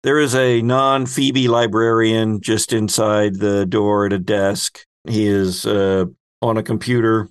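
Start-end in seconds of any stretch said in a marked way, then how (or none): none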